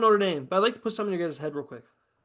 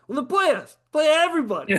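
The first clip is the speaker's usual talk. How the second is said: heated retort; 8 semitones higher, 6 dB louder than usual. Retold or imitated. Mock argument with other schools.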